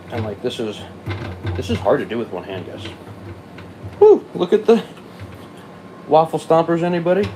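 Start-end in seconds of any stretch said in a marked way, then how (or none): 4.0-7.3 high, loud gleeful cheer; excited whoop. Real, not acted. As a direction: whoop with glee. Cheering at the spinning extractor.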